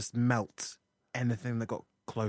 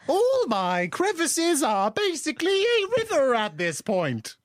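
squeaky astronaut voice; 5 dB louder than usual. Acted. Improv astronauts overheating.

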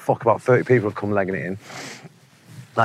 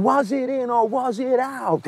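much higher, slow line-call protest; mock McEnroe; 14 semitones higher, slower than usual. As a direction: drag it out, mock indignant. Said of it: "he's going".

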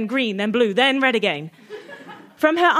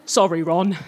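low sheepish apology; 7 semitones lower than usual. Acted. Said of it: "he said sheepishly".

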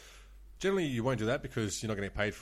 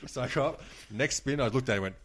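usual speaking voice

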